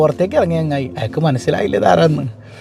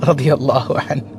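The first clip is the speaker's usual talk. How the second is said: gentle reveal; 5 semitones lower than usual. Retold or imitated. Imam reveals himself gently.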